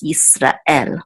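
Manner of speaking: Yisrael slow, stress landing on El; stress on the last syllable. Shown as a demonstration. Teacher giving the correct stress, slow. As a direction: draw out Yisrael and land on El. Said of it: "it's on the last syllable".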